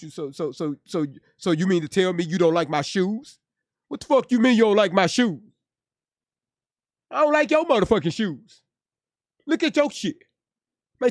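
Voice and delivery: hammed-up furious comeback; mock shoe argument. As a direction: play the offended guy getting heated. Acted shoe quarrel.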